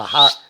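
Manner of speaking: a short, sharp mock whistle call; imitated whistle. Asked what it sounded like, he mimics the whistle. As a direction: whistle it the way the thing answered him. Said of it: "Like that?".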